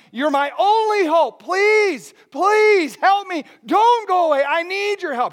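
loud, high, pleading cry; desperate begging. Retold or imitated. Leper clinging to Jesus, crying out in loud desperate pleas. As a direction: beg as if he won't let go. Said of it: "he's imploring and begging him".

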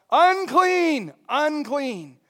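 loud warning call, fading on the second; a lighter retold shout; yelling unclean. Leper calling out to strangers, a milder mock yell. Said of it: "other than to yell out".

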